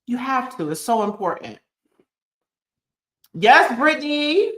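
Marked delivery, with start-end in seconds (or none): 3.3-4.5 high, loud and drawn out, cheering a viewer on; excited shout-out. Real, not acted. Host calls out a commenter's name, excited. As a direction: lift it up high and loud for Brittany.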